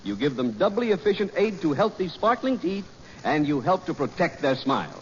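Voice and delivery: normal talking voice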